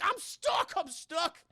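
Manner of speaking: high-pitched mock panic; hammed-up frantic squeal; panicked cry. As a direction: cry for help like a trapped kid. Billy's jacket caught in door.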